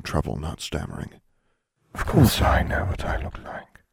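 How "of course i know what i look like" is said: grim reaper voice. A narrator's reading. Death speaks, whispered.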